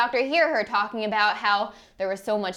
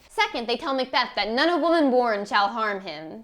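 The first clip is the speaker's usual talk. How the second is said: normal talking voice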